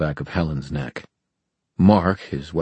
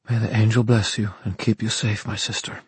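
solemn blessing; 5 semitones higher than usual. A narrator's reading. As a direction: bless her gravely and tenderly. Grave blessing.

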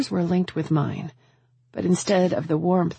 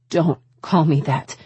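understated sting in the voice; stinging rebuke; 4 dB louder than usual. A narrator's reading. Woman rejecting his nickname, stinging. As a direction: warn him off firmly. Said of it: "my voice stinging his ears".

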